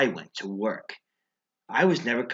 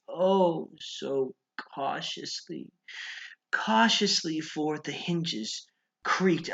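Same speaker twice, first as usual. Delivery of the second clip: drawn-out cautious; 6 semitones higher, slower than usual. Acted. Stretching the word.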